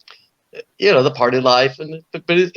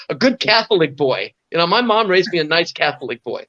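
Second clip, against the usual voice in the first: normal talking voice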